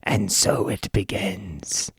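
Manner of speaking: low, slow, soft villain line; hammy menacing villain intoning; ominous villain. Retold or imitated. Evil man watching the heroes fight, low ominous murmur. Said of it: "The evil man stares on and says".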